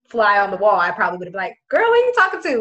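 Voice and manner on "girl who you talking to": high, loud mock outrage; sassy call-out, pitched way up; indignant retort. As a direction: playfully confront someone who overstepped. Imagined indignant retort to the teacher.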